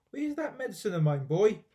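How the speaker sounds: put-on high, loud cranky old woman calling; a grandma yelling from the next room; shrill old grandma voice. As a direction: bark it like a mean old granny. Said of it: "came the voice".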